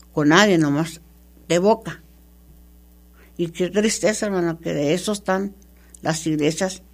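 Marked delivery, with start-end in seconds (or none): none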